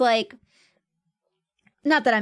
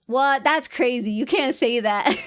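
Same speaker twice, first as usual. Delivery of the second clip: ordinary talk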